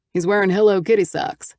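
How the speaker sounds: a lightly voiced man, mildly disapproving. A narrator's reading. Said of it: "a distinct note of disapproval".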